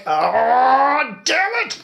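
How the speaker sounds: drawn-out groan rising into high cursing; hammed-up cry of annoyance; mock exasperation. Retold exasperated reaction to squeaking.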